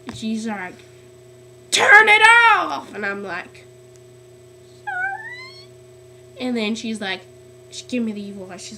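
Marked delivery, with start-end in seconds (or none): 1.7-2.9 high, loud, drawn-out mock yell; retold teacher yell. Teacher ordering a student to turn it off, retold yell. 4.8-5.7 tiny, very high, softer sorry; squeaky apology. Retold or imitated. Student answering an angry teacher, squeaky mock apology.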